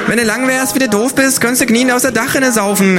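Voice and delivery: a high, cocky kangaroo. A narrator's reading. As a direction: fire back a cheeky insult. Kangaroo insult contest.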